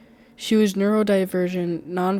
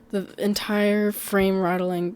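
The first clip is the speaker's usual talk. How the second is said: plain reading voice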